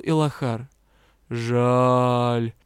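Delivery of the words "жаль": low, regretful otherworldly sigh; disappointed sea being; the ocean spirit mourns that the lord lives; sad ocean spirit. A narrator's reading. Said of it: "печально сказала чернильно-чёрная вода".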